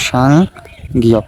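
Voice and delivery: সাংগিয়ক slowly, split into two parts with a long gap; slow pronunciation demo. Shown as a demonstration. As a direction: draw out সাং, pause, then গিয়ক. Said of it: "একটার উচ্চারণ আছে". (সাংগিয়ক ধীরে ভেঙে ভেঙে উচ্চারণ)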